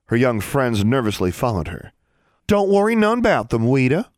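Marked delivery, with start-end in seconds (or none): 2.5-4.1 put-on Ozark girl voice. A narrator's reading. Sister comforts in Ozark voice.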